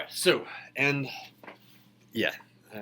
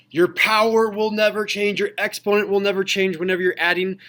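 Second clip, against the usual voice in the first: loud, higher-pitched proclamation of a rule; emphatic rule recital; 6 semitones higher, 8 dB louder than usual. Retold or imitated. Teacher reminds class of the giant rule, loud emphatic recital. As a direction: drum the rule in, loud and high.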